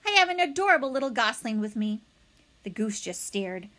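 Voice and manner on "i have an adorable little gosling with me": higher, quick and louder; brisk, friendly call; friendly robot voice. A narrator's reading. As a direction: announce it cheerily across the water. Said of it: "the robot's friendly voice boomed".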